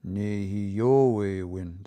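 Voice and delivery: Nehioewin very slow, pitch peaking on yo; pitch change moved along. Shown as a demonstration. Teacher demonstrates Nehioewin slowly, pitch change moved over. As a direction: stretch every syllable of Nehioewin, swell on yo. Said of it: "That pitch changes, it moves over a little bit".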